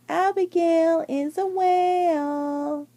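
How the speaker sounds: slow, sing-song teasing chant; mock the big girl slowly; kids teasing. A narrator's reading. Kids taunting Abigail at the pool, sing-song. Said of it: "all the other kids teased her, saying".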